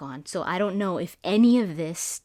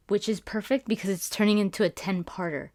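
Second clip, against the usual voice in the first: ordinary talk, nothing marked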